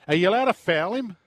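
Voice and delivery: usual speaking voice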